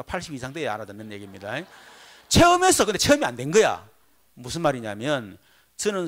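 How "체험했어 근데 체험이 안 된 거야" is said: loud, high preaching cry; preaching shout. Real, not acted. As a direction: call it out to the congregation. (회중에게 크게 외치는 설교)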